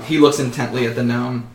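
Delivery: ordinary delivery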